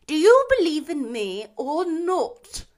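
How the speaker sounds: ghostly challenge. A narrator's reading. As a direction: press him like a spirit. Ghost questions Scrooge.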